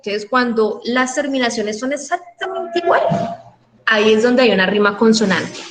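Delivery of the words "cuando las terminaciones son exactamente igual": usual speaking voice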